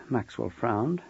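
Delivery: ordinary delivery, nothing marked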